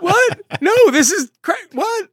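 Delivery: shocked disbelief. Retold or imitated. Acting out the panel reacting to the plan, shocked. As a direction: squeal it in outrage. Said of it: "there's so much incredulity".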